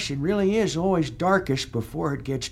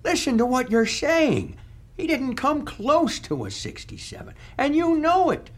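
climbing higher as the disbelief grows; rising incredulous scold; 9 semitones higher than usual. Acted. Ghost scolds the senator over the golf score, rising high. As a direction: scold him, pitch rising to outrage.

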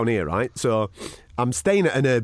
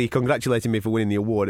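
normal talking voice

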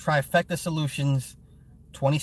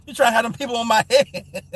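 higher, louder laughing protest; laughing accusation; 7 semitones higher, 6 dB louder than usual. Real, not acted. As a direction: playfully accuse him between laughs.

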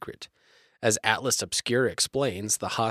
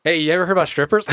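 goofy fast put-on voice; 7 semitones higher, 7 dB louder, much faster than usual. Silly put-on voice.

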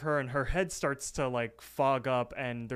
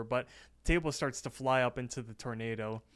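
ordinary talk, nothing marked

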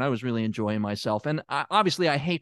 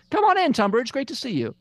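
high, loud, overly bright welcome; forced cheerful welcome; 11 semitones higher, 3 dB louder than usual. Real, not acted. Therapist covering embarrassment welcomes Tunbridge in, overly cheerful.

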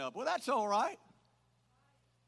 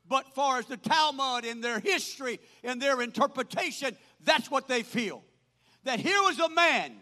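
raised, ringing pulpit delivery; full-force preaching; 5 semitones higher, 9 dB louder than usual. Real, not acted. Preacher telling how God used Naaman, full-force preaching. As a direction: build phrase on phrase, punching words loud.

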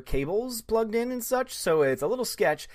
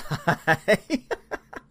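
amused laugh; 3 semitones higher than usual. Real, not acted. Laughs at plot.

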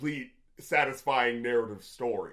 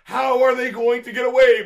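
incredulous; 7 semitones higher, 8 dB louder than usual. Retold or imitated. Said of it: "I'm just like".

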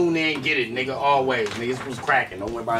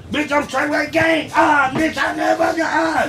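higher, slow shouted rap yell; 8 semitones higher, slower than usual. Retold or imitated. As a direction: yell it like a hype rapper. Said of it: "'Cause it was [name]".

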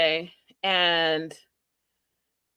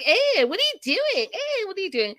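high squeaky mock protest, imitating an antsy boyfriend; hammed-up high-pitched panicky dude; mock flustered guy; 13 semitones higher than usual. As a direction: squeal it like a guy caught off guard. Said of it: "The guys are like".

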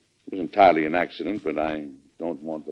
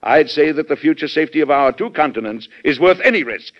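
impassioned; 4 semitones higher, 6 dB louder than usual. Acted. President forcefully defends his policy.

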